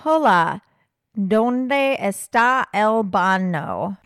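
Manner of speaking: put-on slow, butchered Spanish in a heavy American accent. Imitating a Cuban man mocking Americans, in bad American-accented Spanish. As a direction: plod through it as a mock American tourist. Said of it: "The Americans speak like this".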